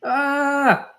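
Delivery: sudden high yelp; a short startled cry; mock boy's yelp.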